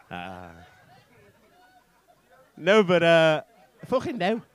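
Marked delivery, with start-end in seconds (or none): 3.9-4.4 higher-pitched mock Scouse; Scouse accent. Comic repeating his Scouse catchphrase as an aside, light Scouse accent. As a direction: toss the Scouse phrase out, pitched up. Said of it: "one word that I can say in a scouse accent".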